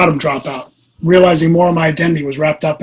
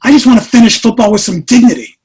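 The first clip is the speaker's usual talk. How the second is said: louder, higher retold complaint; exasperated protest; 4 semitones higher, 5 dB louder than usual. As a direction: act out a frustrated husband pushing back. Acted quarrel with his wife.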